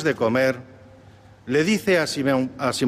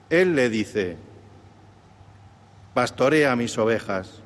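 solemn Gospel reading; 4 semitones lower than usual. Acted. Deacon proclaims the Gospel reading.

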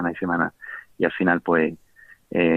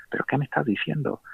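astonished, higher; 7 semitones higher, 6 dB quieter than usual. Retold or imitated. Salió del confesionario con las manos en la cabeza.

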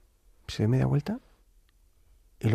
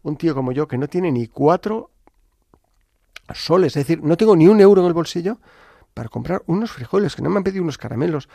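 retold scolding; 3 semitones higher, 6 dB louder than usual. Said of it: "le empecé a echar una bronca al señor".

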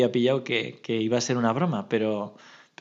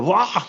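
loud, high "buah" of a jump scare; shouted scare noise, retold; startling "buah"; 7 semitones higher, 5 dB louder than usual. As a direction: burst out with a sudden boo.

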